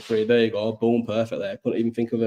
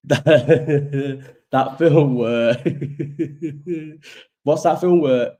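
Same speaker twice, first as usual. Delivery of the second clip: laughing through the words; giggling fit; 5 semitones higher, 5 dB louder, much slower than usual. Real, not acted. Trying to recall a film for viewers, giggling helplessly. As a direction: keep cracking up as you try to speak.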